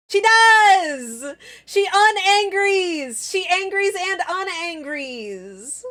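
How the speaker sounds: high, gleeful cheer, easing off at the end; high-pitched gushing excitement; delighted squeal. Real, not acted. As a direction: squeal in triumph that it works. Delighted that the animation works.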